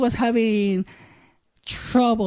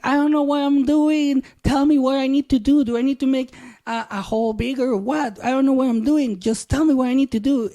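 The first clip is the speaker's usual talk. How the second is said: raised, louder pleading in frustration; exasperated protest; 6 semitones higher, 4 dB louder, faster than usual. Retold or imitated. Husband begs wife for instructions mid-argument, exasperated. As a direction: act out a flustered demand for help, pitched up. Acted quarrel with panicking wife.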